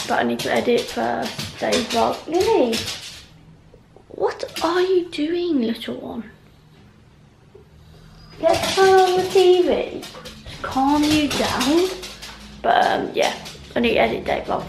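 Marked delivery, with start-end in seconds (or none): 2.3-11.9 high, slow cooing to the pet; soothing pet talk. Real, not acted. Fussing over Lily to settle her, high slow coo.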